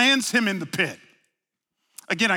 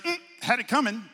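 shrugging dismissal; 5 semitones higher, faster than usual. Retold or imitated. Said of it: "I shrug my shoulders like".

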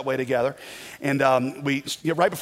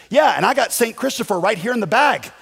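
raised, higher imitation of an eager stranger; loud butting-in; 8 semitones higher, 6 dB louder than usual. Stranger suddenly joining an overheard conversation, loud and high. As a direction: butt in loudly as the other guy.

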